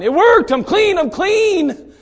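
triumphant yell of relief; shouting. Retold or imitated. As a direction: shout it out like a healed man. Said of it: "He was shouting".

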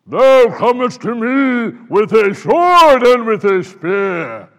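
loud, slow giant's boom, voice unlike his own; booming Goliath voice. Pretend. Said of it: "I can only imagine what his voice sounded like".

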